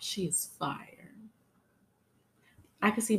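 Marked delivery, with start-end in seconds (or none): 0.0-1.1 whispered aside. Real, not acted. Host whispering praise about Blue, soft and unvoiced. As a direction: breathe it as a secret.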